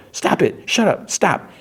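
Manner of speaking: high squealed retold protest; short high bursts, acted out; mock pleading. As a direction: beg it to stop in a high imitation.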